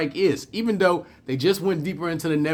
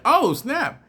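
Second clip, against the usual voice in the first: "Oh, snap" jumped much higher in mock alarm; caught off guard; 11 semitones higher than usual. Woman caught sneaking up on Worf, mock surprised yelp.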